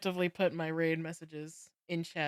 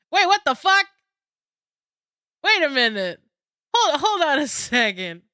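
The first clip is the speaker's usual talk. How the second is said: shocked; 9 semitones higher, 11 dB louder than usual. Real, not acted. Shocked at what streamer does.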